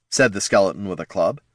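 ordinary delivery, nothing marked

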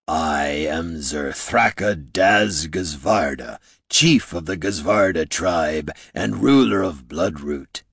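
low, slow rumble like distant thunder; deep, measured voice promising coming fury; thunderous orc chief. A narrator's reading. Orc chief announcing himself to the heroes, thunderous. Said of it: "a voice like distant thunder, low and promising coming fury".

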